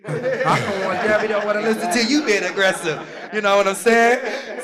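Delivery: loud, high laughter through the words; laughing. Real, not acted. Student laughing at his own aggressive-mom joke, loud and high. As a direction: laugh out loud while saying it.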